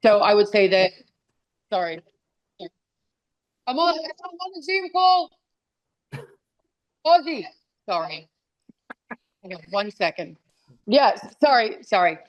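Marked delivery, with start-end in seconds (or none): 3.7-5.3 telling off the dogs. Real, not acted. Scolding dogs off the call. 7.0-7.4 calling the dog. Real, not acted.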